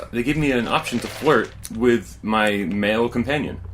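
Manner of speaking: ordinary talk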